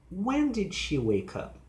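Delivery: high "When", sliding down to "up"; falling question. Shown as a demonstration. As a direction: stress "When", then let pitch drop. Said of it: "falling pitch".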